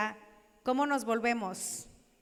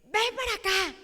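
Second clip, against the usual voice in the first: higher, louder call across the house; mother yelling; 8 semitones higher, 4 dB louder than usual. Retold or imitated. Parent shouting for little Marifer to come, higher and louder. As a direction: call the child over sharply. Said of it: "y le gritaban".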